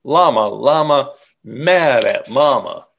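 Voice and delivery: ordinary delivery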